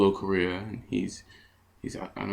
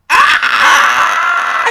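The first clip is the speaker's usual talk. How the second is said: startled shout; 14 dB louder than usual. Retold or imitated. Startled reaction to stranger.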